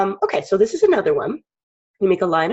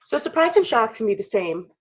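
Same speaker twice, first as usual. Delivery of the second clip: usual speaking voice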